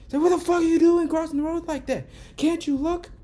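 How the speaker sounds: high, loud mocking of a cursing driver; angry driver yelling. Retelling how the driver cursed him out, mock angry yell. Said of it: "He's cursing me out".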